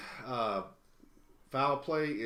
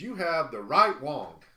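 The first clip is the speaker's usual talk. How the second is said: much louder on the reveal; louder, excited reveal; 4 semitones higher, 10 dB louder than usual. Real, not acted. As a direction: announce the prize with a burst. Announcing the big pull.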